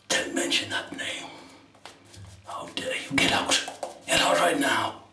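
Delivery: hushed, playful mock-angry whisper; whispered mock outrage. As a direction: whisper the threat for a laugh. Replaying the joking staff lines.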